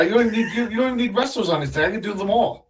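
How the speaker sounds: high wrestler promo voice; Big Vito impression. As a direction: cut a brash wrestler promo. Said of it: "I can do a Big Vito impression".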